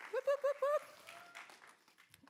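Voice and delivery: high, drawn-out whoops to hype the crowd; whooping cheer. Real, not acted. Host hyping the audience after the welcome, with drawn-out high whoops. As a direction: stretch each whoop up high.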